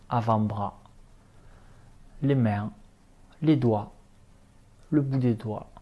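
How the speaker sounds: ordinary delivery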